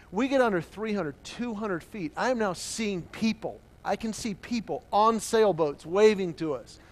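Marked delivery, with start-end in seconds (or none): none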